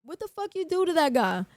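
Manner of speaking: high astonished questioning; shocked security guard. Retold or imitated. Security guard asking about the injury, high stunned surprise. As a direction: ask it stunned and amazed. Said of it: "he's like".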